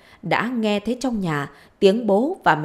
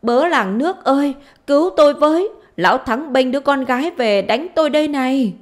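high, louder narrated outcry; screaming for help; 7 semitones higher, 5 dB louder than usual. A narrator's reading. Wife calling neighbours to save her, screaming for help. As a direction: cry out to the whole village. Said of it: "thì lại nghe Trang gào thét".

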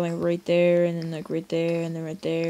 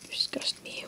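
whispered disdain; 12 dB quieter than usual. Pretend. Insulting a game item.

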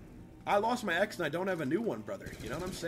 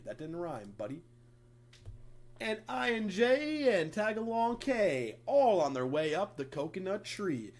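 sing-song read-aloud, higher and louder on the chants; reading a kids' book; 4 semitones higher, 7 dB louder, slower than usual. Acted. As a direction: read it like bedtime for toddlers. Reads children's book aloud.

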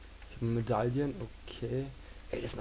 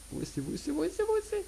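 rising panicky search; faster than usual. Real, not acted.

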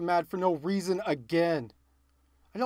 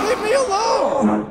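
high, loud, slow cry of leave me alone; desperate shout; 14 semitones higher, 8 dB louder, slower than usual. Real, not acted. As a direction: yell at the creature chasing you. Creature attacking in game.

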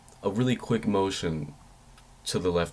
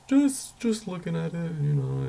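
much higher, squeaky caught-out excuse; nervous squeak; 11 semitones higher, slower than usual. Retold or imitated. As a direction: squeak the lame excuse like a guilty kid. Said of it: "I was like".

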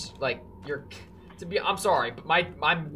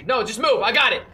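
loud, higher-pitched impatience; a little heated; 5 semitones higher, 8 dB louder than usual. Real, not acted. Player taking over from companion, heated impatience. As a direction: snap it out over their efforts. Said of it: "Got me a little heated".

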